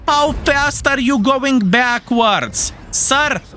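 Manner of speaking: slow, high mocking question; mock disbelief. Real, not acted. Streamer taunting a retreating enemy, slow high mock. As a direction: tease the fleeing tank, drawn out.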